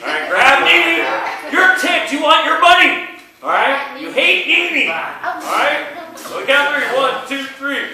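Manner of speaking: very high and loud, urging; hyped-up coaching. Real, not acted. Teacher pumping up the class for a retry, loud and excited. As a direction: fire them up like a coach. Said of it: "let's do this again".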